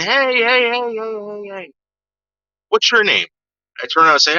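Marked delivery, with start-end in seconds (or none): 0.0-3.3 mock come-on in a high voice, trailing heys; calling woman. Bar patron hails the teller from the corner, high imitation of a woman. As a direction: imitate a woman hailing you, pitched way up. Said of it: "I see this fucking woman saying".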